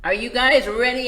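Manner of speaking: eager sheep; excited sheep. Acted. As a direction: pump the band up before the show. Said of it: "asks Sheep".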